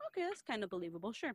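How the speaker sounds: soft, quick retold concession; mock tentative acceptance. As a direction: murmur it quickly, going along with him. Acted hesitant acceptance of his story.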